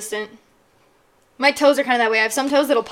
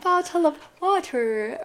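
slow, higher-pitched mock British accent; Nathan's bad British accent; 4 semitones higher, 5 dB quieter, slower than usual. Host showing how Nathan says it, badly faked British accent. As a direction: put on a hopelessly fake British accent, stretched out. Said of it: "When he does a British accent".

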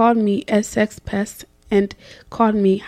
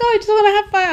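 mock surprised quote; 11 semitones higher, 5 dB louder, much faster than usual. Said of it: "I was like".

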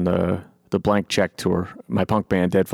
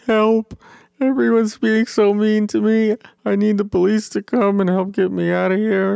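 high, whiny mock cry for help; mock whiny plea; 13 semitones higher, 3 dB louder than usual. Mocking a rally guy begging police for help, high whiny voice. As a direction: whimper it like a pathetic victim.

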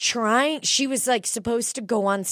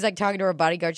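usual speaking voice

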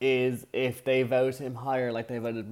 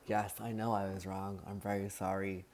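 much softer, composed apology; calm apology; 4 semitones lower, 10 dB quieter than usual. Retold or imitated. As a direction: say sorry without raising your voice. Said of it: "Like, not raising your voice".

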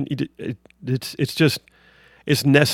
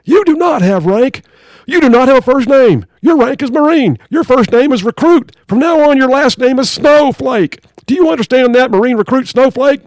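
drill sergeant; 10 semitones higher, 7 dB louder than usual. Acted. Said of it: "drill sergeant".

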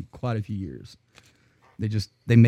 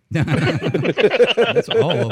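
laughing; 6 semitones higher, 5 dB louder than usual. Real, not acted. Laughing reply.

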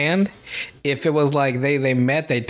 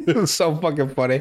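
normal talking voice

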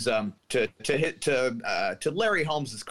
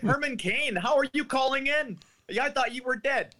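much higher, disbelieving host voice; incredulous caller; 13 semitones higher than usual. Retold or imitated. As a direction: squeak it out in disbelief. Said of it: "And the guy says".

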